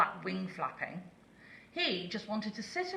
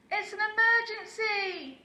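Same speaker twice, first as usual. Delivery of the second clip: very high, loud alarm call; urgent shout; 5 dB louder than usual. A narrator's reading. Lazy owl fakes an emergency to the horse, shouting. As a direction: sound the alarm in a panic. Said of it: "cried Aussie".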